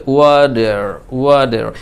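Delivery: American "ওয়াটার", t turned to d, final r sounded; American accent. Shown as a demonstration. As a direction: say "ওয়াটার" American style, as "wa-der". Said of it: "যদি আপনি American accent অনুযায়ী". (American উচ্চারণে "ওয়াটার", "ওয়াডার"-এর মতো)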